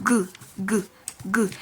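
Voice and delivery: G said as a short isolated "gu"; G sound demo. Shown as a demonstration. Teacher drilling the G sound for learners, G sound demo. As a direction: sound out G as "gu", evenly spaced. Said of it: "the G sound".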